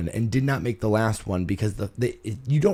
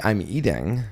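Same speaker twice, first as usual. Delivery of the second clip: ordinary delivery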